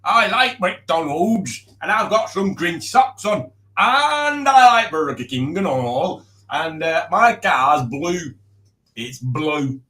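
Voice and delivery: exaggerated mock voice, lurching up in pitch; impression of Matt. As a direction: ham up a friend's voice, dragging out the words. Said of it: "that's what Matt sounds like".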